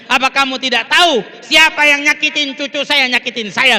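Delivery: preacher voicing a forceful reprimand; acted stern rebuke. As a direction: rebuke them forcefully at full voice. Prophet rebukes companion, acted.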